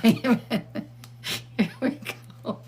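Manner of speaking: cracking up while talking; helpless laugh between the words; real laughing. Real, not acted. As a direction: squeeze the words out through laughter. Cracks up while showing the picture.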